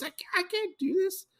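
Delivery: very high mock-despair squeak; comic high-pitched panic; acted despair. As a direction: jump way up in pitch, hamming up helplessness.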